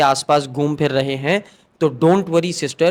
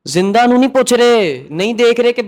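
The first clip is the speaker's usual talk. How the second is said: heated outburst; 6 semitones higher, 5 dB louder than usual. Real, not acted. Passionate complaint continues.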